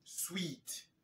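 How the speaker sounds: "Sweet" whispered with a long ee. Shown as a demonstration. A teacher whispers "Sweet" to the class, showing the long ee. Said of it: "C'est la même prononciation".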